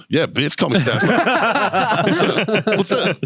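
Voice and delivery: high, slow, drawn-out crowing; gloating. Retold or imitated. Marine silently gloating in court at a witness, cocky crowing. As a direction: crow it in mock triumph, stretched out.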